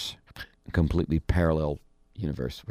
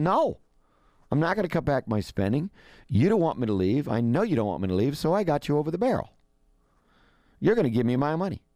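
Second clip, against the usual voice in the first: higher-pitched mock stubbornness; hard-line defiance; 7 semitones higher than usual. Tsipras refusing the European lenders, mock hard-line defiance. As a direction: imitate someone who holds all the leverage, pitched up. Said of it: "he's drawn a hard line".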